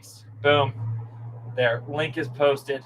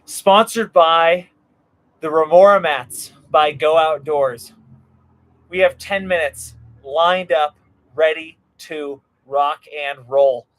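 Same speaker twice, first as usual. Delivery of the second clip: announcer; 10 dB louder than usual. Acted. Announcer intro for sponsor.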